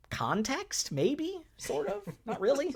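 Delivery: rising, doubtful questions; unsure. Real, not acted. Trailing uncertain questions.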